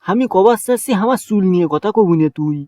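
ordinary delivery

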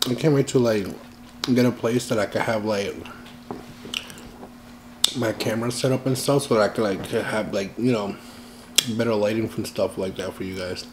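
speaking between bites; talking while chewing. Real, not acted. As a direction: mouth partly full of food. Seen 2: Eats cheesy fries throughout; fork held at lips while speaking.

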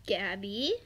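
ordinary delivery

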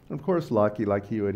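ordinary talk

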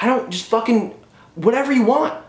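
high-pitched, fed-up brush-off; imitated exasperated director. Director to actors asking about accents, mock exasperation. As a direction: wave the question away, voice up high. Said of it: "the director was just like".

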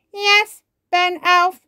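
the robot obeys Ben; obedient robot. Acted. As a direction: answer like a dutiful robot. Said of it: "replies the robot obediently".

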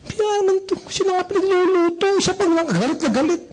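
high-pitched, aggrieved wife voice; complaining wife. Acted. Wife who cooked and got anger back, complaining. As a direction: whine back as the put-upon spouse. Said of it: "Sabi ng asawa mo".

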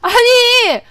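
high, loud wail of protest; indignant cry. Real, not acted. Player protesting a mishap in game, indignant cry. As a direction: cry out against the injustice.

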